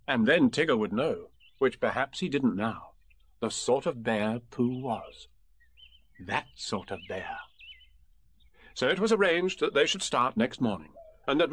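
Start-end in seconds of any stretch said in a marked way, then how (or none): 3.4-5.3 slow, self-satisfied emphasis; proud. A narrator's reading. Narrator relishing Pooh's pride, slow. 6.2-7.4 much softer, slow echo; proud aside. A narrator's reading. Narrator echoing Pooh's pride, soft and slow.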